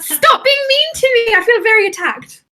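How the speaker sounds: high, loud playful whine; teasing the GM in a high, loud voice; mock hurt whine. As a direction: pretend to be wounded by the GM. Player mock-complaining to the GM.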